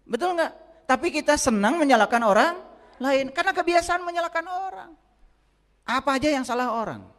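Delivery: high, loud sermon voice; fiery preaching. Acted. Preacher rousing the congregation, loud and high. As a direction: preach it out to the whole hall.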